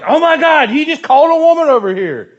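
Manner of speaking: high, loud mock gasp of disbelief; a hammed-up shocked gossip. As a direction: play the scandalised bystander.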